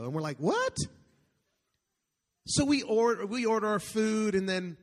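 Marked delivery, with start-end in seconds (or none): 0.4-0.8 very high-pitched, incredulous squeak; acted-out astonishment shooting sharply up in pitch; mock-stunned, rising yelp of a question; shocked disbelief. Acting out the shocked reaction.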